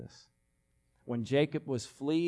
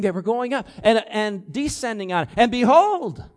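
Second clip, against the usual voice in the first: louder and higher, each behold punched; carried-away speaker; 6 semitones higher, 8 dB louder than usual. Retold or imitated. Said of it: "a guy like a speaker really carrying on".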